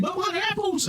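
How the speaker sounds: very high-pitched lady's protest. Acted. Lady interrupts the men about her apples, high-pitched protest. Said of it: "the lady, Bertram, starts saying".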